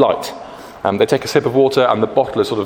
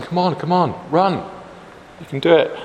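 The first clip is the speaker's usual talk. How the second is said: high-pitched cheer without much volume; mock shouting; 7 semitones higher than usual. Bible's faithful shouting to runners from the finish, high mock cheer. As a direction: imitate spectators urging runners on.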